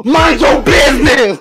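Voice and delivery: slow, high, loud yell; shouting. Acted. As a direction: belt it out, drawn out. Shouted punchline of a bit.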